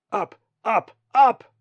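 high, loud, rising exclamations; urgent climbing cry. A narrator's reading. Colin straining upward in flight.

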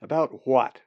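loud, annoyed reply; irritated. A narrator's reading. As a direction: snap back, fed up. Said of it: "Zack replied in an irritated voice".

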